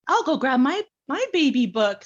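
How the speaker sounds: high and loud, eager to fetch something; bubbling over, can't wait to share it; excited. Retold or imitated. Excited daughter dashing off for her baby book, high eager voice. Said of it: "and I was so excited".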